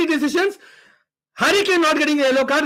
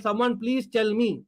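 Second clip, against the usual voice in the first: ordinary delivery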